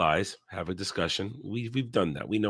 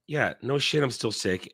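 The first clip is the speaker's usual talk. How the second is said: usual speaking voice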